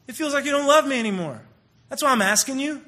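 lightly voiced upset child; hurt child. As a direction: plead like a kid in trouble. Voicing a kid in trouble.

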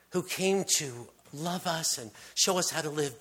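usual speaking voice

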